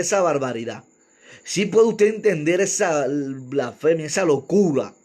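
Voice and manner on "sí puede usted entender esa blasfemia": ordinary talk, nothing marked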